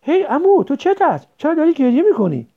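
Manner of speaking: high, loud anxious question; worried asking. A narrator's reading. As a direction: ask him urgently, alarmed why he cries. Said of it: "پریشان پرسید".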